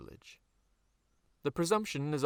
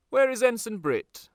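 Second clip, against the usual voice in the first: loud, high officer's demand; parade-ground shout for a name; commanding call; 13 semitones higher, 9 dB louder than usual. Acted. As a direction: call out across the assembled company. Commander calling out to company.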